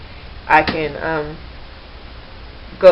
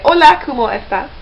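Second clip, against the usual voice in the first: "Hola, ¿cómo estás?" in a Spanish accent, pitched higher; Spanish greeting; 7 semitones higher, 4 dB louder than usual. Shown as a demonstration. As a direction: offer "Hola, ¿cómo estás?" brightly as a learner's demo. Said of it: "in Spanish, I would say".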